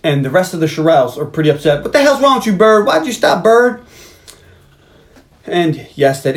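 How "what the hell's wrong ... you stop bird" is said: high, loud exasperated yelling; mock yell. The other Shirelles scolding [name] for stopping, high and loud.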